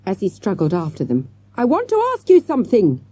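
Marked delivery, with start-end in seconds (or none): 1.6-3.0 high, louder call; shouting. A narrator's reading. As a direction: call out after people walking away. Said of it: "the man shouted".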